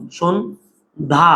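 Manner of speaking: শোন then ধা, with a clear gap between them; split into syllables. Shown as a demonstration. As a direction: break the word into শোন and ধা. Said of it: "আমরা তো এটাকে এইভাবে উচ্চারণ করছি".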